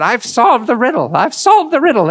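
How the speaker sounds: high, excited imitation of a German accent, rising on the repeat; gleeful old prospector in a thick German accent. Imitating Eric boasting over gold nuggets, in a German accent. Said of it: "in his adorable German accent".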